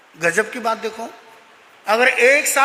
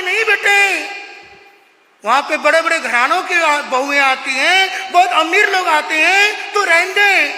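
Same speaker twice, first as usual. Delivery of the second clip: lightly voiced mother; 5 semitones higher, 4 dB louder than usual. Mother voiced refusing child.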